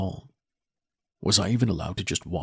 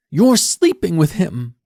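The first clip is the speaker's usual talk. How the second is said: high, loud, slow tearful accusation; accusing daughter; 10 semitones higher, 10 dB louder, slower than usual. Acted. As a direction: spit out the accusation.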